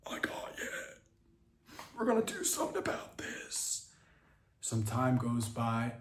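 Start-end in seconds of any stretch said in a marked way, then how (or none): 0.1-3.9 whispered comfort. Acted. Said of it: "Mike starts to tear up".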